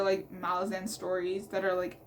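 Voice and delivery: ordinary talk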